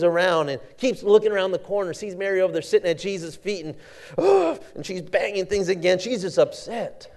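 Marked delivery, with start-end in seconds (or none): 4.2-4.6 high, exasperated ugh; a fed-up grunt at her sister; frustrated grunt. Acted. Martha, fuming at Mary in the kitchen, frustrated grunt.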